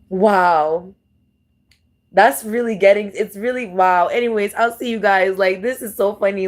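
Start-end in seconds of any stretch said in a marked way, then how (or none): none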